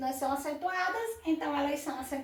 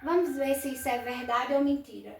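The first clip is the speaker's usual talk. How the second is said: usual speaking voice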